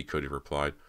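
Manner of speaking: ordinary delivery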